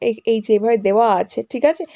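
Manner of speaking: ordinary delivery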